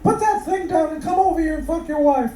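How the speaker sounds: high and fast, nagging the silent husband; impatient wife. Acted. Wife berating her silent husband, high and fast. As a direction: rattle it off up high like an exasperated wife.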